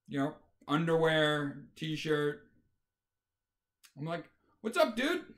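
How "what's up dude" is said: bright, much higher, overly chummy hello; mock-cheerful greeting. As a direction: greet him like an old buddy to unsettle him. Cheerfully greets wife's date in underwear.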